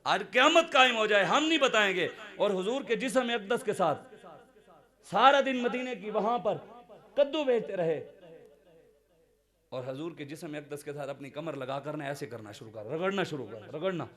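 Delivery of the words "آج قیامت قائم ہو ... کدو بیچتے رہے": high, forceful storytelling from the pulpit; impassioned preaching. Real, not acted. Preacher repeating Zahir's refusal to the crowd, impassioned preaching. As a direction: raise the voice and declaim it firmly.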